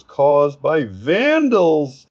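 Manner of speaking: high, loud mock outcry; exclaimed quote. Commentator quoting a politician's post about vandals, exclaimed high and loud. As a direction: cry it out as a quoted accusation, voice shooting up. Quoting his post's accusation.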